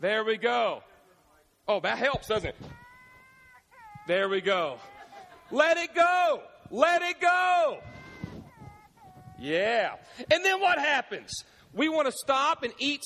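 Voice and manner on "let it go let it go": shouted high, slow singalong; kids belting. Retold or imitated. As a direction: belt it like a kid in the car. Kids singing song in car.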